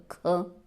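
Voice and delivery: ख pushed out with a strong puff of breath; ख ज़ोर लगाकर, महाप्राण की तरह; aspirated ख demo. Shown as a demonstration. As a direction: say ख with extra force of air. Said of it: "थोड़ा जोर पड़ेगा".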